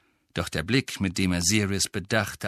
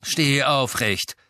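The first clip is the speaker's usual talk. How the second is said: raised, higher, stretched-out command; gruff order; 6 semitones higher, 3 dB louder, slower than usual. A narrator's reading. Master ordering the bowing elf up, gruff. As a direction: order him up brusquely. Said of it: "fuhr ihn Sirius unwirsch an".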